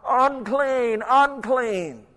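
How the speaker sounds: high, loud leper's warning call. Acted. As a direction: cry it out long and loud to clear the way. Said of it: "They had to call out".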